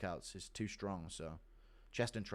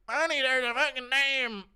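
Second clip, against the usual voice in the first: much higher, loud frantic farmer; Bryce impression; 12 semitones higher, 6 dB louder than usual. Husband calling his wife about a snake, high and frantic. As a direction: yell down the phone in panic. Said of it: "impression of Bryce ... called his wife".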